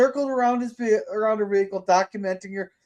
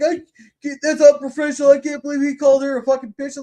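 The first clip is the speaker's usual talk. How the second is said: higher, louder imitation of indignant critics; mock outrage; 6 semitones higher, 5 dB louder, faster than usual. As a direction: parody everyone being scandalized. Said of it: "Which everybody's like".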